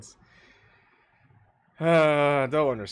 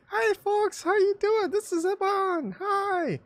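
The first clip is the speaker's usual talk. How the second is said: much higher, thin tinny phone-speaker voice; 7 dB louder, slower than usual. Shown as a demonstration. Mimicking his voice through a smartphone, tinny and high. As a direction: squeak it out like a cheap smartphone speaker. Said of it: "it's all tinny".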